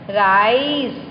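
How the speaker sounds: "rise" with the i drawn out; long vowel sound. Shown as a demonstration. Teacher reading out a long I word, drawn-out vowel. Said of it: "This is long sound".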